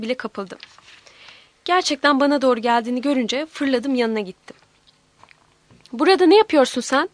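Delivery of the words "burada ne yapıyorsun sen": louder, higher surprised question. A narrator's reading. Aunt finding the child outside, asking in a loud voice. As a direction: call it out loudly. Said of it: "dedi yüksek sesle".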